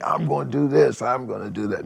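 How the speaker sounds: a light Ali-style boast; Muhammad Ali boast. Retold or imitated. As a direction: imitate a bragging champ. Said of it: "the Muhammad Ali type thing".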